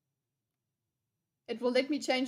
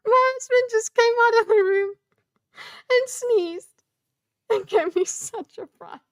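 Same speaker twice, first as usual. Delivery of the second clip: startled; 9 semitones higher, 10 dB louder than usual. Real, not acted. Startled right after the sneeze.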